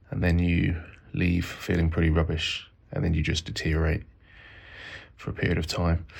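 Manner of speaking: low, tired, hungover voice; flat and worn out after chemo; drained. Real, not acted.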